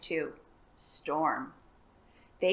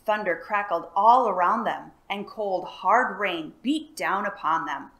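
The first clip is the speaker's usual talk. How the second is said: usual speaking voice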